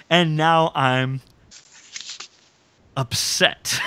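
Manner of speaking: drawn-out, dramatic pause before upset; mock-menacing TV agent, slow and deliberate; slow Jack impression. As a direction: savour it slowly like a grim action hero. Favourite Jack line, savoured impression.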